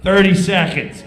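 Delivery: loud countdown shout; countdown call. Retold or imitated. Stage manager counting down, loud call. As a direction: bark the time left.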